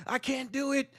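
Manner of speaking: high, whiny mock of a torn-up man; mock simp voice. Mocking men who can't fight the simp, high whine. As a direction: squeak it out like a weak man begging himself. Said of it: "I'm like".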